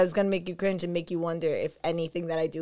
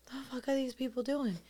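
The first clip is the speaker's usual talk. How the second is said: soft muttered rant to yourself; quiet exasperated inner voice; inner grumble; 3 semitones higher, 8 dB quieter than usual. Retold or imitated. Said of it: "I'm just like".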